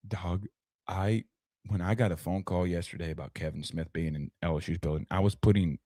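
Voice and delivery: low, soft, secretive undertone; hushed confiding. Pretend. Host confiding about yesterday's phone call, hushed and low. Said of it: "I gotta whisper this".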